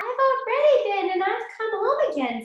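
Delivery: the pig cheekily showing he's been already; little pig voice. A narrator's reading. Pig teasing the wolf, high. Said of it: "said the pig".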